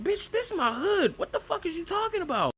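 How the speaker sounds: high, fast outraged squeal with a laugh; mock outraged yell.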